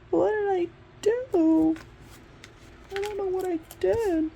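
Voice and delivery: high, slow whiny wail; hammed-up despair after the second rejection email; mock despair. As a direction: wail it like a hurt kid.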